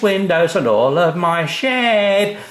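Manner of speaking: put-on high, loud little-boy voice; child's voice. A narrator's reading. A boy showing off his shed, in a high child's voice. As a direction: speak as the boy who loves his shed.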